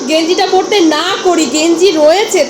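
exasperated scolding. Real, not acted. Wife chiding Chandan for always wearing the same vest, exasperated.

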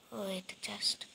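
soft whisper while looting. Real, not acted. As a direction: murmur it under your breath. Quietly checking a chest.

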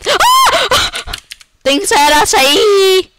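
high, slow, loud frightened cry; startled yell. Real, not acted. Gamer cries out mid sentence in a scare.

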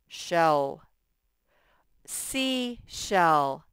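normal talking voice

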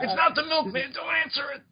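very high, hammed-up panic; mock alarm. Warning a sitcom family at the door, mock alarm. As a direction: play a melodramatic warning, laughter nearby.

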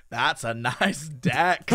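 low, slow mock Scottish accent; Scottish guy. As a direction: imitate a Scot, drawing each word out. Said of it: "Scottish guy who was like".

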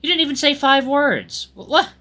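high-pitched, incredulous outburst; indignant. Acted. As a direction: accuse in disbelief, voice jumping high. Jealous friend confronting Yuichi.

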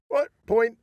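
high, loud call of point; mock referee call. Retelling the match to the co-host, a mock referee shout. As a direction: bark out the point like a ref. Referee in retold Viking bout.

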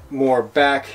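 ordinary delivery

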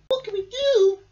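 high, loud worried squeak. Acted. Mouse worries about the stranded Mole, squeaking. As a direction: squeak in a panic. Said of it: "squeaked Mouse".

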